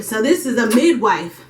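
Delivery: normal talking voice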